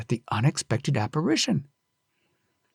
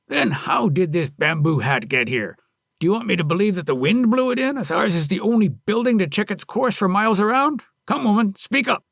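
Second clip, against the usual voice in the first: quicker, demanding answers; angry husband; 6 semitones higher than usual. Acted. Husband demanding Onami explain the hat, angry.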